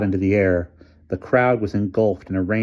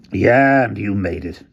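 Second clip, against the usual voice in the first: jibing; 6 dB louder than usual. A narrator's reading. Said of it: "jibed Hamilton".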